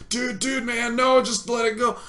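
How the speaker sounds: high-pitched mock plea to a friend; urgent pleading. Acting out restraining upset friend.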